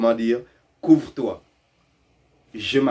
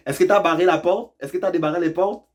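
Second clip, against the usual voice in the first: asking someone; 6 semitones higher, 4 dB louder than usual. Shown as a demonstration. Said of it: "Ça veut tout simplement dire".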